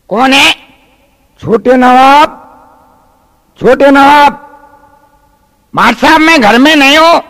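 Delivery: high, loud spoilt little nawab; bratty child voice. Acted. Naughty boy shouts at his tutor through the door, high and loud. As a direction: play the cheeky brat shouting from inside.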